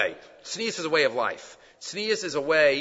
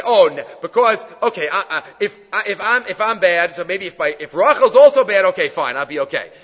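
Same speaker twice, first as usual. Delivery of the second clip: louder, flustered self-talk with stumbling restarts; Leah's anxious inner voice; 3 semitones higher, 8 dB louder than usual. Retold or imitated. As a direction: voice Leah talking herself into it, a bit louder and rushed. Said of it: "we say".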